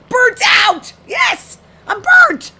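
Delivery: loud, high-pitched yelling; emphatic shout. Real, not acted. Reading about job burnout and seeing themself, emphatic shouting.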